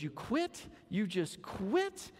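high incredulous, second one drawn out slowly; half-hearted astonishment at the answer; mock disbelief. Man reacting to the dog's quitting, mock surprised.

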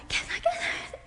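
eager whisper. Shown as a demonstration. Rushing to friend, eager whisper. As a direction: breathe it out, thrilled and secretive. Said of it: "I came over and I was like".

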